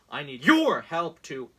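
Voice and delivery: hammed-up fundraising plea; mock ad read. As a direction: beg like a campaign text. Reading Trump campaign text aloud.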